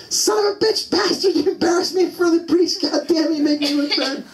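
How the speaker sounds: very high-pitched imitation of mom's tirade; mock furious mother. Mom scolding her boys after the priest exposes them, mock furious. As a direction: screech like an enraged mom in a comic retelling.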